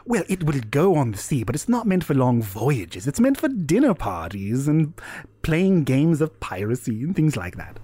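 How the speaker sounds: lively, swooping character voice; lightly voiced non-player figure. Explaining a boat to the party, lightly voiced figure. Game master plays talkative figure.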